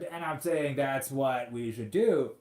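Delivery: ordinary talk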